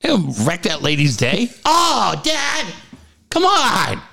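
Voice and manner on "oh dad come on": very high mock child's whine; high, exasperated kid impression; kid yelling at dad. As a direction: imitate a little boy scolding his dad. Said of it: "yelling at me".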